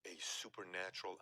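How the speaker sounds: ordinary delivery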